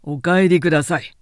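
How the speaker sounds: loud curt order to leave; rough shout. Acted. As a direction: bark it out. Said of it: "乱暴にわめいた".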